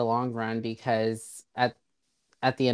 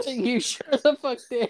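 laughing; 12 semitones higher than usual. Real, not acted. Laughing accusation.

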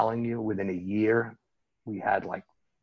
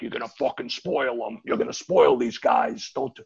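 put-on grumpy old guy; 10 semitones higher, 5 dB louder than usual. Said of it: "the old grumpy guy in the back is like".

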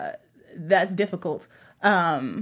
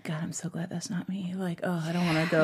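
whispered aside as a relieved onlooker; hushed inner thought; 3 semitones lower, 8 dB quieter than usual. Acted. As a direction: voice a private thought under your breath. Voicing a bystander's private relieved thought.

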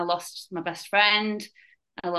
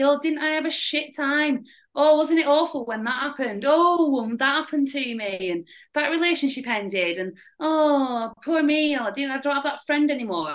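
victim mentality; 6 semitones higher than usual. Retold or imitated. Mocking his future self wallowing in self-pity, mock whine. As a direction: ham up the poor-me moaning. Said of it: "that victim mentality".

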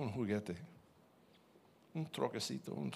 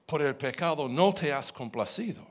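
solemn, measured reading of a psalm; scripture reading; 5 dB louder than usual. Acted. Preacher reading scripture to the congregation, solemn.

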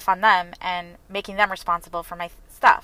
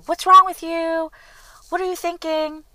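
retold yelling; 9 semitones higher, 4 dB louder than usual. Stranger yelling at her over a traffic mistake, high mock yell. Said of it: "started yelling at me and saying".

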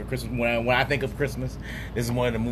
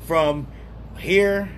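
drawn-out show host announce; podcast announcer; 6 semitones higher, slower than usual. Acted. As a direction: stretch it like a countdown host.

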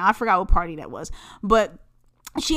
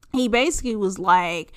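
normal talking voice

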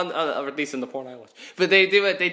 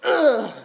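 loud, higher-pitched exertion grunt; mock tennis grunt; 6 semitones higher, 5 dB louder than usual. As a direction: imitate a tennis player's suggestive grunt. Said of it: "they grunt like they're in a porno".

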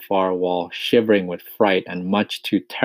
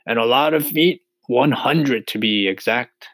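mildly raised, boasting character voice; proud centipede; 5 semitones higher, 5 dB louder than usual. A narrator's reading. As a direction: state it proudly, drawing out the end. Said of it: "the centipede answered proudly".

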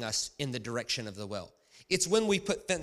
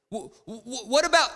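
high, loud, stuttering mock objection; hammed-up sputtering heckler; stammered crowd question; 11 semitones higher, 10 dB louder than usual. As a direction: stumble into a yelled question. Imagined questioner stammering, cut off.

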